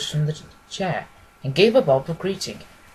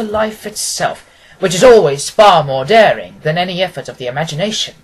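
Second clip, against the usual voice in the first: cordial Holmes; 2 semitones higher, 7 dB louder than usual. A narrator's reading. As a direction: welcome your old friend in with open arms. Holmes reminds Watson.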